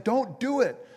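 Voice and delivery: urgent plea. Acted. Bystander to man about to jump off a bridge, urgent plea. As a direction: call out to stop a jumper.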